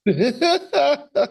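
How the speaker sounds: high, loud burst of laughing; loud laughter. Real, not acted. Host hearing co-host's flirting story, real laughter. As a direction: crack up at the story.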